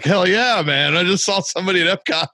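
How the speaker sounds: high, fast and thrilled; buzzing after spotting a fellow fan; excited. Retold or imitated. Fan retelling meeting another Forest fan, excited and fast.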